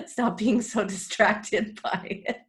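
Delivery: amused, laughing through the words; slow down and let laughs break into the phrase. Real, not acted. Meditation teacher on her chatting mother, laughing.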